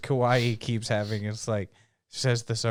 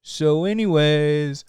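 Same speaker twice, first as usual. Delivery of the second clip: high, loud, slow sarcastic sing-song; smug mock dismissal; 6 semitones higher, 6 dB louder than usual. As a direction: toss it off like nothing matters. Mocking a smug character.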